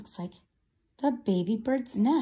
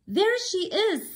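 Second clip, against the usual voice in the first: high, eager cry; excited baby bird; 8 semitones higher than usual. Acted. Bird seeing the boat, high excited chick. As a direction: spot her and light up.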